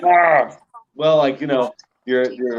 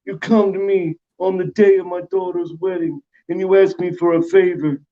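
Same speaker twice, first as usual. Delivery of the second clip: raised-pitch Italian-accented mobster; mock Italian don, higher than usual; 6 semitones higher than usual. Shown as a demonstration. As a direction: put on an Italian godfather voice. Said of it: "You got your Italians".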